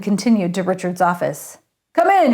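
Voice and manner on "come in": higher, loud booming welcome. A narrator's reading. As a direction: boom it through the door. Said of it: "he boomed".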